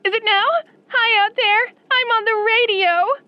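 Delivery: can't believe she's on the radio; gushing fan on the air; thrilled. Acted.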